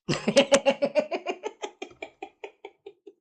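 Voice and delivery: long, high cackle of ridicule; a gleeful jeering laugh that rises; high mocking laugh. Real, not acted. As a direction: laugh at the nickname. Laughs at the nickname he gave.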